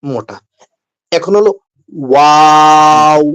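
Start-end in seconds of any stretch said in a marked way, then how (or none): 2.1-3.2 long slow ওয়াও held out; teacher modelling ওয়াও slowly; drawn-out waw. Shown as a demonstration. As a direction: stretch ওয়াও into a long waaaw. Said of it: "okay ওয়াও বলি না".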